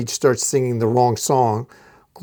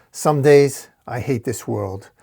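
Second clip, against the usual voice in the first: ordinary delivery, nothing marked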